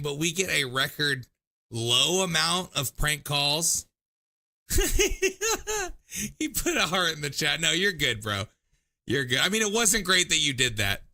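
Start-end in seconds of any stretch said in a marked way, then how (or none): 4.7-6.3 loud high-pitched laugh. Real, not acted. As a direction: burst out laughing. Host laughs after prank.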